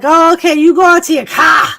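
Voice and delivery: loud, high mocking echo; mocking coworkers. Hospital coworkers making fun of her accent, loud mockery. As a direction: parrot it back loudly, making fun of the accent. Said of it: "were making fun of my accent".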